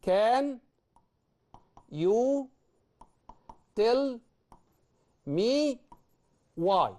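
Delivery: word by word. Shown as a demonstration. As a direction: dictate each word for the board. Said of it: "نبدأ بقى كده نقول".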